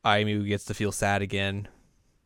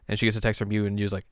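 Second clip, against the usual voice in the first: normal talking voice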